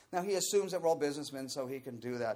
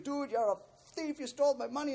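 mock screaming; 12 semitones higher than usual. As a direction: imitate a dealer yelling accusations. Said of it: "starts screaming".

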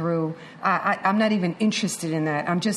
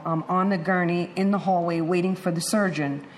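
ordinary talk

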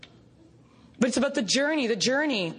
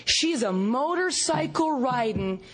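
ordinary talk

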